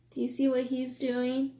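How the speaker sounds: ordinary delivery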